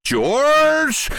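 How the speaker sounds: high, drawn-out call; excited farmer calling his son; father's shout. A narrator's reading. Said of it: "Mister Cranky shouted".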